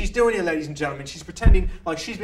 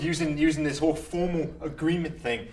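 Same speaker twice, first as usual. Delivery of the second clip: ordinary talk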